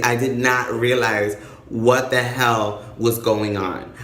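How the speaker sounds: ordinary talk, nothing marked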